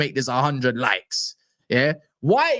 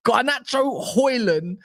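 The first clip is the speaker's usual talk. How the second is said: normal talking voice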